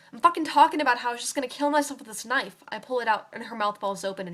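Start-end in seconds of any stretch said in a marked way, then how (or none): 0.1-2.6 louder, higher, swearing in anger; angry outburst. Acted. Girl lashing out at Donna over the knife, angry and loud. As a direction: spit the words out at her.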